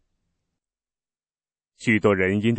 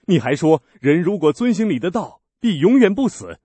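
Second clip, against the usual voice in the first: his words thrown back higher and louder, 遵 rising in scorn; mocking quote; 7 semitones higher, 7 dB louder than usual. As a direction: parrot his claim with contempt. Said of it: "那些犹太人说".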